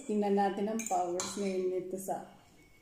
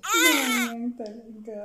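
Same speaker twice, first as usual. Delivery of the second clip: teary; 3 semitones higher, 9 dB louder, slower than usual. Real, not acted. Teary from spice.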